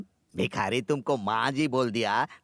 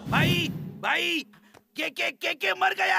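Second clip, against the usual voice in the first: high, frantic shout that the boss is dead; panicked yell; slower than usual. Acted. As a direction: scream it out in alarm, dragging the first words. Henchman yelling boss is dead. (घबराकर ऊँची आवाज़ में चिल्लाना)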